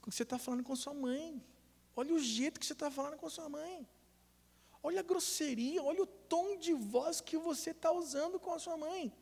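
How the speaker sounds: high, soft, incredulous reprimand; hushed scolding. Retold or imitated. Father corrects child's tone toward mother, hushed high scolding.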